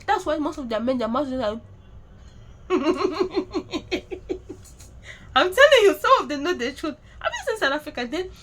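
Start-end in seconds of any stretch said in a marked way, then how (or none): 1.6-5.3 airy unvoiced chuckle; mocking giggle under the breath; breathy laugh. Real, not acted. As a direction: let a silent, breathy laugh escape. Mocking South Africans' mouths.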